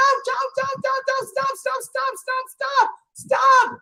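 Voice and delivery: high pained ouch, stop; crying ouch. Acted. Robber yelping at the scalding teapot, high. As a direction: yelp as hot water hits. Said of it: "The robber began to cry".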